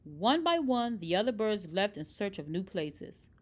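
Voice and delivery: ordinary delivery, nothing marked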